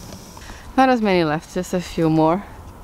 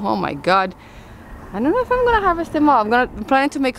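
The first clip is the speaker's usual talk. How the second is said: much higher and fast, overwhelmed by the crop; excited surprise; 8 semitones higher, faster than usual. Real, not acted. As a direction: gush about the huge harvest. Overwhelmed by huge pepper crop.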